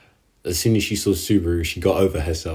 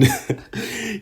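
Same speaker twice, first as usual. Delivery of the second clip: a short, high, softer chuckle; soft high laugh; 4 dB quieter than usual. Real, not acted. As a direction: chuckle in disbelief. Laughs again, disbelief.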